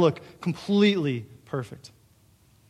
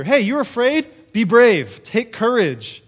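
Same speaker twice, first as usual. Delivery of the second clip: higher, louder hearty cheerleader voice; mock pep talk; 5 semitones higher, 6 dB louder, slower than usual. Mocking the usual advice given to the fearful, hearty and loud. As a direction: pump them up, mockingly. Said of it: "here's what we typically hear".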